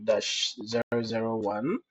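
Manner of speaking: ordinary delivery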